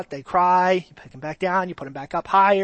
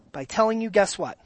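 usual speaking voice

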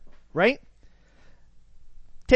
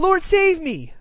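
crying out; 8 semitones higher, 5 dB louder, slower than usual. A narrator's reading. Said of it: "crying out".

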